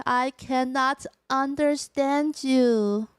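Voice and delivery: very slow, deliberate English; careful, measured alien self-introduction; alien newcomer introducing herself. Pretend. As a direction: space out every word like a newcomer.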